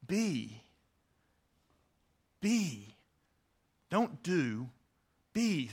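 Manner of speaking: BE standing alone, drawn out between pauses; stress on BE. Shown as a demonstration. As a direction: dwell on the word Be, letting each one hang. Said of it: "you emphasize different words".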